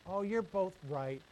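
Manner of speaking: slow, put-on mock agreement; dragged-out mock-tolerant reply. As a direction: sneer it like a weak compromise. Mocking relativist view.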